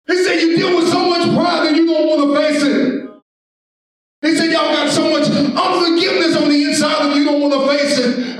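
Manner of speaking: high, shouted pulpit exhortation; full-force preaching. Acted. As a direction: shout it out to the congregation.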